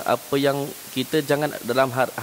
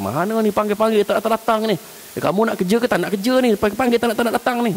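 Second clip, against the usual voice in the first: higher, faster scolding of the worker; angry boss; 9 semitones higher than usual. Retold or imitated. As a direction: tell the lazy worker off. Chef schimpft.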